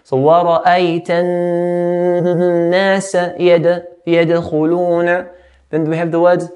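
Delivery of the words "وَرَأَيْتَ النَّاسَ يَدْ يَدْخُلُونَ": long hummed n in النَّاسَ, bouncing d in يَدْخُلُونَ; ghunna and qalqala. Shown as a demonstration. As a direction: recite holding the noon of النَّاسَ, bounce the d. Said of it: "which is called the ghunna".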